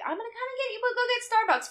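high, bright friend voice; cheery friend voice. Retold or imitated. Imitating friend Jen inviting her out, high and cheery. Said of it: "My friend Jen was like".

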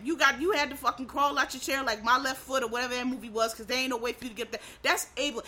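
ordinary delivery

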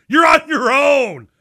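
high, loud and slow, yelled while fleeing; panicked shout. Retold or imitated. Ten-year-old abandoning his mom to the bat, panicked shout. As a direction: yell it back as you bolt. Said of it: "I'm like".

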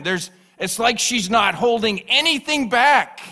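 loud, rising sermon voice; fervent preaching. Acted. Pastor preaching to the congregation, at full force.

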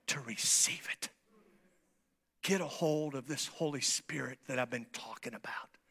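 hushed, unvoiced plea; a pastor's intimate whisper. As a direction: whisper it close to the listener. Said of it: "I can whisper it".

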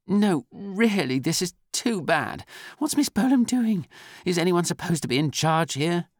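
loud, high outburst of exasperation; exasperated, high-pitched protest; irritated exclamation. A narrator's reading. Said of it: "Fear gave vent to irritation".

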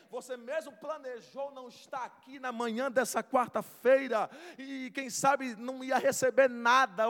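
loud, driving sermon delivery; full-force preaching. Acted. Preacher rousing the congregation, loud preaching. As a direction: preach it hard to the crowd.

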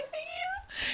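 very high, very soft thank you; tiny choked voice. Retold or imitated. Quoting her tearful self thanking the star, squeaky. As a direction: squeak it out, overwhelmed.

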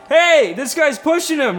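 loud, high, drawn-out call; mock alarmed shout. Friend calling out about the pushing, mock alarmed shout. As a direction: imitate a kid shouting to raise the alarm.

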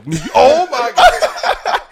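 high-pitched laughter breaking through the words; cracking up while trying to talk; laughing. Real, not acted. Cracking up at co-host.

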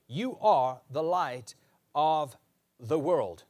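each word of "You are the light" spaced out and stressed; slow emphatic declaration. Acted. Preacher declaring to his congregation, slow and emphatic. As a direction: lay it down slowly word by word.

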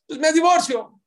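high, loud mocking spouse; a spouse saying it offhand, in a high mock voice; mock threat. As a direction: toss off the threat, pitched high. Spouse threatens divorce, retold.

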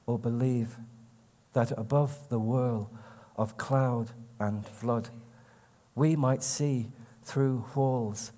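slow, lifted poetry reading voice; poem reading. Acted. Poet reading his own verse to an audience, in a slow recital voice. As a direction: savour each line like a recital.

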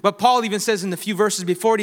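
usual speaking voice

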